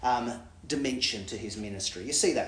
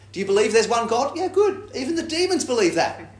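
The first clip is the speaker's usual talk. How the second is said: laughing, much higher and louder sarcastic "yeah, good"; ironic praise; 10 semitones higher, 7 dB louder than usual. Retold or imitated. James teasing believers about demons, laughing irony. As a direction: tease them with mock approval.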